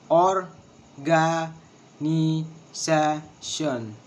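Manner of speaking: "Organization" very slowly in separate syllables. Shown as a demonstration. Teacher showing the syllables of organization, syllable by syllable. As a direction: stretch each syllable and leave a gap after it. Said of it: "six syllables".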